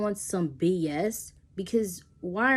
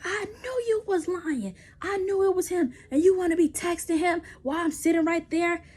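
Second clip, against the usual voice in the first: going off; 9 semitones higher than usual. Retold or imitated. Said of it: "and he's just going off".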